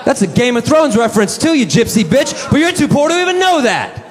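very high, sneering put-on voice; mocking taunt. Imagined retort to the homeless woman, high sneering taunt. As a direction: taunt her in a shrill mock voice.

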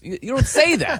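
ordinary talk, nothing marked

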